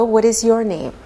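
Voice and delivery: ordinary delivery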